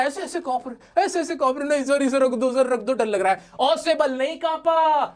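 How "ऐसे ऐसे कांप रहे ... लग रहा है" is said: mock trembling student. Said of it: "तुम तो डरने लगे".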